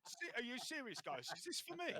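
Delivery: high put-on character voice; comic, squeaky astonishment; touched Les. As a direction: ask in high-pitched disbelief at a present. GM acts Les receiving the arm.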